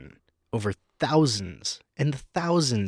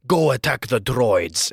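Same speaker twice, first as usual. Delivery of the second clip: slow, lightly put-on Grievous command; imitating Grievous; 4 dB louder, slower than usual. Podcaster imitating Grievous giving orders, mock slow. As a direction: drag out the robot general's order, half-hearted. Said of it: "all he does is".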